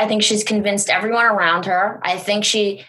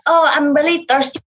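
hybrid French-Spanish accent; faster than usual. Shown as a demonstration. Demonstrating a vacation accent to co-host, French-Spanish hybrid. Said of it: "a hybrid French and Spanish".

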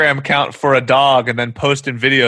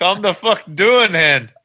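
Scottish scold; 6 semitones higher, slower than usual. Pretend. Telling off the woman in Tesco, in a high Scottish voice. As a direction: rebuke her in broad Scots. Repeats own line.

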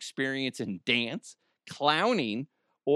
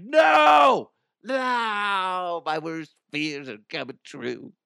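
mock terror; 5 semitones higher, 7 dB louder, much slower than usual. Diner seeing the clown appear, mock horrified shout. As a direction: shout no, then a hammed-up whimper of dread. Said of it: "Be like".

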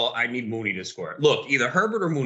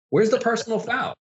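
high-pitched, incredulous challenge; indignant protest; 10 semitones higher than usual. Real, not acted. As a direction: demand an answer from the referee. Protesting a referee's call.

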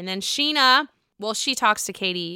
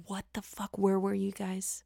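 stunned hush; 11 dB quieter than usual. Real, not acted. Stunned whisper.

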